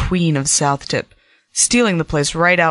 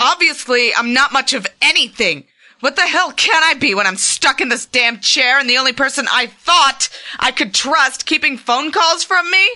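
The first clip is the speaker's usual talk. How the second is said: bitter anger; 9 semitones higher than usual. Acted. Bitter argument with partner.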